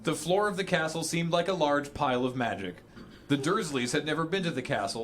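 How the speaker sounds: ordinary delivery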